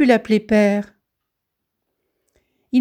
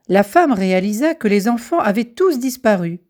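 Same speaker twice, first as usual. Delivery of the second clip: usual speaking voice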